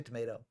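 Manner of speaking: "tomato" with a long AY in the middle; own American pronunciation. Shown as a demonstration. Commentator giving their own way, "tomato" with AY. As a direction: say "tomato" as tuh-MAY-toe. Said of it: "I say tomato".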